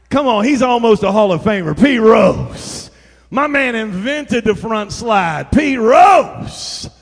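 loud, high repeated shout of Pete Rose; shouted name. Real, not acted. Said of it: "preacher shouting name".

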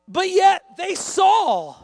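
preacher pressing the lesson, loud and high; full-force preaching. Acted. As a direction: hammer the point home to the congregation.

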